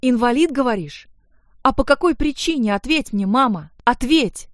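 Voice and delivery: high, loud demand for an answer; outraged. A narrator's reading. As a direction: confront her mother, pressing harder. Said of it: "будучи вне себя от возмущения".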